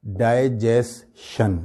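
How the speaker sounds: "ڈائجیشن" slowly as die-jes-shun; common mispronunciation. Shown as a demonstration. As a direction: break up "ڈائجیشن", ending in SH. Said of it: "ایسے ہی بولتے ہیں نا ہم لوگ". ("ڈائجیشن" کو "ڈائے جیس شن")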